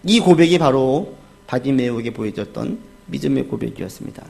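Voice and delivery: normal talking voice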